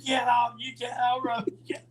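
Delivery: high, urgent imitated plea; alarmed protest. Passenger to Roddy trying to outrun police, alarmed. Passenger begs driver not to flee.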